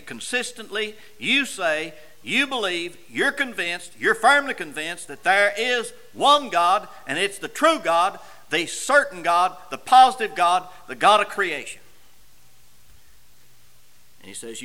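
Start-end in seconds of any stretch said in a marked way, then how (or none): 0.0-11.8 rising, louder sermon build; preaching. Acted. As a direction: stack the phrases, each one louder.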